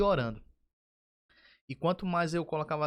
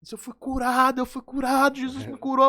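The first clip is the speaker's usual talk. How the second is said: loud, much higher, overjoyed cry; ecstatic shout; 11 semitones higher, 10 dB louder than usual. Retold or imitated. Healed man shouting to his wife that Jesus cured him. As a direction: burst out with joy, shouting.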